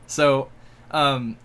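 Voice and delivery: ordinary talk, nothing marked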